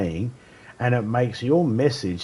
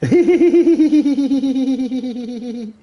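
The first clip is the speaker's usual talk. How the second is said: loud hearty laugh; 7 dB louder than usual. Real, not acted. Laughing hard at own joke.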